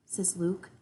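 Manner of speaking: usual speaking voice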